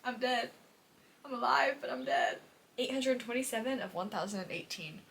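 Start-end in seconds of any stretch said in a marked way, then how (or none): none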